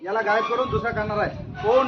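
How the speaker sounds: ordinary talk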